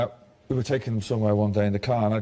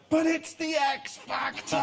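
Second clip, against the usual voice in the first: daughter's high, drawn-out outraged cry; indignant shout; slower than usual. Retold or imitated. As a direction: shout it like a furious daughter who can't believe it.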